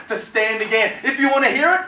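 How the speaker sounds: ordinary delivery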